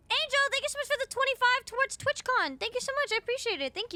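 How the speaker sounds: high bright thank-you to a donor; excited thanks. Real, not acted.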